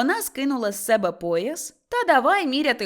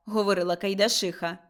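ordinary delivery, nothing marked

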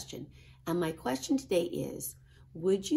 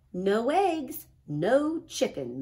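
higher, louder big-sister teasing; big sister Ruby; 6 semitones higher, 6 dB louder, slower than usual. A narrator's reading. Ruby teasing Max about finding nothing, higher and louder. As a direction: tease him from above like a big sister. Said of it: "said Ruby".

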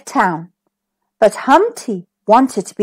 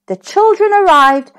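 ordinary delivery, nothing marked

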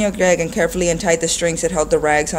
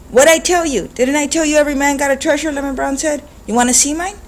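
put-on much higher, lively old man; 9 semitones higher than usual. A narrator's reading. Lemon Brown asking Greg about his treasure, higher. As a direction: tease the boy warmly.

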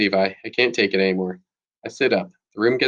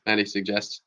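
ordinary delivery

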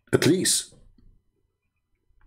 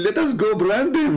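high-pitched mock quote breaking into laughter; 7 semitones higher than usual. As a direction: barely hold the laugh in. Mocking cover-up, breaks into laughter.

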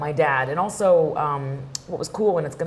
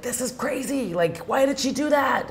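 retold scared dad; 8 semitones higher than usual. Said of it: "my dad got really scared".

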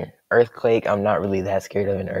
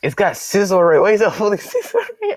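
much higher, louder incredulous cry; shocked disbelief; 11 semitones higher, 6 dB louder than usual. Real, not acted. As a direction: squeal at the surprise item. Shocked at opponent's item.

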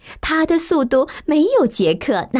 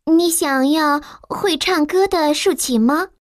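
higher, polite maid question; servant asking; 7 semitones higher than usual. A narrator's reading. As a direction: maid asks the giant. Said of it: "佣人问". (女佣询问)

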